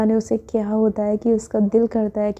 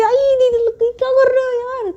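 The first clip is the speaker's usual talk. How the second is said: much higher, whiny ouch; yelp of pain; 13 semitones higher than usual. A narrator's reading. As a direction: cry out like it really hurt. Cousin punched in the back. (दर्द से ऊँची चीख़ती आवाज़)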